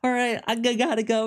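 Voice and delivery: high, rushed polite exit; hurried excuse. Retold or imitated. Doctor Zhang excuses herself from the guard, high and hurried. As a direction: edge away from him quickly. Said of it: "she's like".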